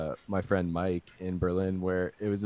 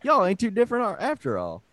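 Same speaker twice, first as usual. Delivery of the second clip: southern impression; 10 semitones higher than usual. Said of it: "your southern impression".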